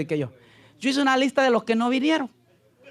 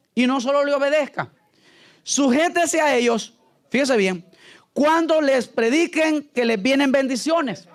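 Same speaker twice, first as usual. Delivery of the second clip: raised, drawn-out preacher's declamation; mock scripture reading; 4 semitones higher than usual. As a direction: proclaim it slowly like a Bible verse. Mock-reading an altered verse.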